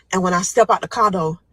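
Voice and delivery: ordinary delivery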